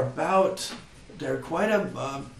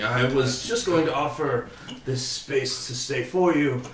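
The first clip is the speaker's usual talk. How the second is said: usual speaking voice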